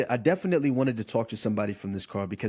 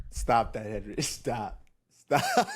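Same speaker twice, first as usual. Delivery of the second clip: laughing; 3 semitones lower, slower than usual. Real, not acted. Laughing at co-host's humming.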